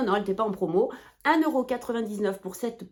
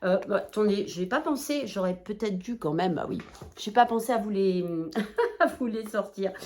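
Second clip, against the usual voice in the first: usual speaking voice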